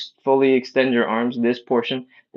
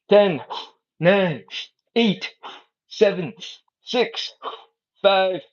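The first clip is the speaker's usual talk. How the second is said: counting down the last reps in a raised, punchy pitch; odd high counting voice; 7 semitones higher, much slower than usual. Real, not acted. Coach counting down core holds, in a high, punchy voice. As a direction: push each number up high like a cheerleader. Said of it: "I don't know why I'm speaking like that".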